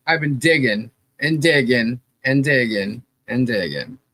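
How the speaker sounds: ordinary talk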